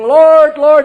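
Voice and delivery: loud mock prayer. Vested priest praying before drinking, loud mock prayer. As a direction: cry out to heaven, hammed-up. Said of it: "I'm saying".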